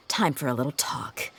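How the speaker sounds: soft whispered threat; menacing whisper. Acted. Interrogator whispering to the captured mercenary, menacing whisper.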